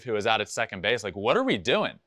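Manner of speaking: usual speaking voice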